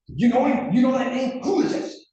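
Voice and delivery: high, loud frantic yelling; panicked shouting. Retold or imitated. Retelling a rattled colleague, frantic high yell. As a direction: shriek it out like a man who thinks he's caught. Tibbetts panicking over fake page.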